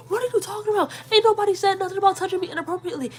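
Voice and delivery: very high, incredulous comeback; incredulous retort. Retold or imitated. I answer her in the quarrel, high-pitched disbelief. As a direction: fire back in a squeaky, disbelieving rush.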